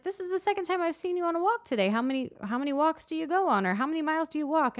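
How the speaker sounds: high, quick chatty questions; nosy lady voice. Retold or imitated. Lady asking the walker about her walks, high chatty voice. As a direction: quiz her like a curious neighbour. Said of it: "she's like".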